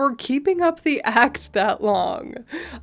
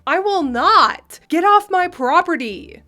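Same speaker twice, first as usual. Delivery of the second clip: indignant entitled mother; 6 semitones higher, 5 dB louder than usual. Acted. Entitled mother refuses to leave, indignant. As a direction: play an outraged mother standing her ground.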